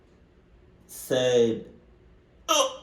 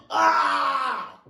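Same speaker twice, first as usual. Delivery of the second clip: startled shout. Real, not acted. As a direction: let out a sharp cry. Startled reaction.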